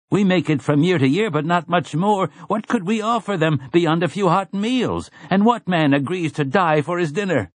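high-pitched character voice of a doubter; objecting villager. A narrator's reading. Villager doubting three can beat sixty, high objecting voice. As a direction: argue the odds in a high voice.

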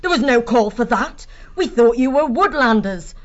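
stung, indignant outburst; pained indignation. Acted. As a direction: yelp and scold the one who hit you. Vixen struck on the nose.

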